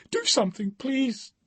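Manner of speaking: high, airy, desperate begging; aspirated desperate plea. Acted. Woman begging the stranger to save Colin, high strangled plea. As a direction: force it out of a strangled throat. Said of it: "The words seem to aspirate from a hole in her throat".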